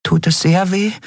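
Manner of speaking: high, small and careful; a small fairy voicing a careful worry; cautious tiny fairy. A narrator's reading. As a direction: ask it gently in a tiny high voice. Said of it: "fragte Nelen vorsichtig".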